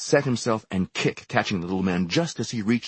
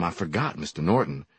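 ordinary delivery

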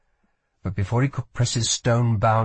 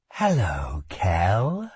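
slow, sinister greeting starting low; eerie, low otherworldly hello; the darkness; 3 semitones lower, much slower than usual. A narrator's reading. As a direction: greet him as a living shadow. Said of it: "said the darkness".

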